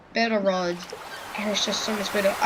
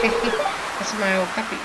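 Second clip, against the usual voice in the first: ordinary talk, nothing marked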